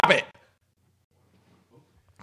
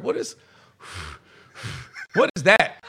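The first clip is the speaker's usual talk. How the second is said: dad scolding; 4 semitones lower than usual. Retold or imitated. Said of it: "my dad catches me and he's like".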